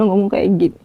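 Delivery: normal talking voice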